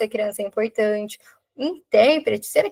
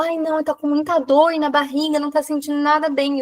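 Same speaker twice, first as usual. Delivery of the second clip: higher-pitched, lightly voiced worried mother; worried mother's complaint; 4 semitones higher than usual. Mother reporting her child's bellyache, lightly acted complaint. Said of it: "também não é na mesma entonação".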